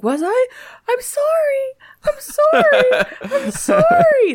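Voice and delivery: squeaky, wailing sleepy apology; mock high-pitched voice. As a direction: whine it way up high, imitating a groggy husband. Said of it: "really high-pitched voice".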